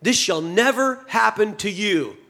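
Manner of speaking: a hint of a heated rebuke, slow; mild mock outrage grabbing his collar; understated angry protest; red-faced protest. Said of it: "red in the face".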